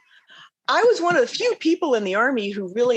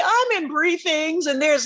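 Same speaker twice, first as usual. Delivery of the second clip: usual speaking voice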